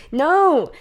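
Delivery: pleading to stop the talk through laughter; exasperated protest. Retold or imitated. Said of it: "I was like".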